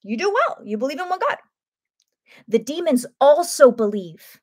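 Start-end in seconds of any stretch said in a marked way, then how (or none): 0.0-1.4 pitched way up, sarcastic; sarcastic praise to the congregation; mock sarcastic praise. Preacher mocks believing without obeying, sarcastic high lilt.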